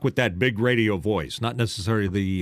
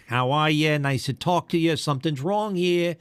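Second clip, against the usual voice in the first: put-on New York accent; 6 semitones higher than usual. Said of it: "talking like they had clam chowder".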